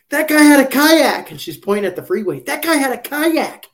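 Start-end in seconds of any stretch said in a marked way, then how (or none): 0.1-1.3 high, loud, drawn-out mock alarm; mock overzealous sheriff. Imitating sheriff spotting an unchecked boat, mock urgent yell. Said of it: "almost like she was on a drug bust". 2.4-3.7 high-pitched, hammed-up urgent outcry; mock overzealous sheriff. Imitating sheriff pointing at the freeway, mock frantic cry. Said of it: "almost like she was on a drug bust".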